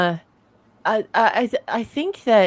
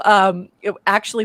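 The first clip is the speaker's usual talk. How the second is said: usual speaking voice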